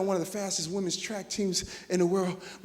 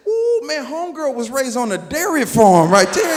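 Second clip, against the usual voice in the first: excited; 5 semitones higher, 12 dB louder than usual. Retold or imitated. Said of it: "I told my homeboy".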